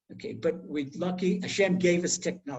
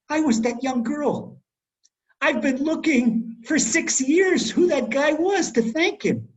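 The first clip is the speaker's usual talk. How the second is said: emotional reveal; 8 semitones higher, 5 dB louder, slower than usual. Retold or imitated. Girl reveals she was saved.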